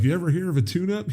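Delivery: mock the kid's old car, quick and high; snide boyfriend. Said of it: "he's like".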